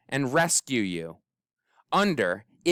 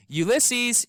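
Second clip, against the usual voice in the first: shout; 11 semitones higher than usual. A narrator's reading. Tootie calling for Ulysses in the dark, shouting. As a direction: call into the dark woods. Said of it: "shouted Tootie".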